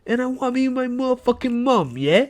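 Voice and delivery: put-on Cockney accent; Tom Holland, Cockney. Co-host posing as British actor quitting Spider-Man, mock Cockney. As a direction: do a hammed-up Cockney. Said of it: "That was a good British Cockney accent".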